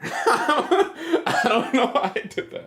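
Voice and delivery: high-pitched giggly talking; words squeezed out through real laughter; laughing. Real, not acted. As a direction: crack up while confessing. Giggles at own hyper outburst.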